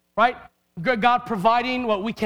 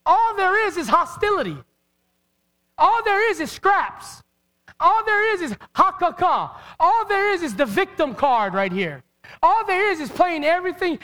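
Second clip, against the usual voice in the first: preaching at full force; 4 semitones higher than usual. Acted. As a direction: pound each line harder. Building, repeated preaching lines.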